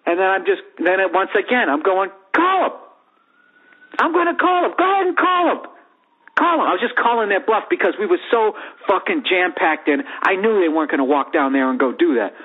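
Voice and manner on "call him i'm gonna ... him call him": high, cocky mock taunt; taunting dare. Daring the guy to call security, high and cocky. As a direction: egg them on to call security.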